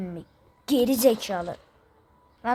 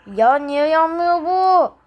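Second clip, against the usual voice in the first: slow, louder, higher exasperated yell; frustrated yell; 4 semitones higher, 7 dB louder, slower than usual. Acted. Fighter yelling at enemy that won't burn, frustrated. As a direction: demand why it won't burn.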